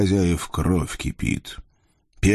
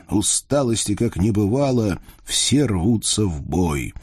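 usual speaking voice

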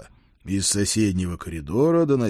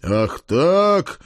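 higher, gleefully worked-up exclamation; joyful roar; 8 semitones higher, 4 dB louder than usual. A narrator's reading. Lord loosens his belt at the page, roaring gleefully. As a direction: roar it with wild glee. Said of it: "радостно взревел я".

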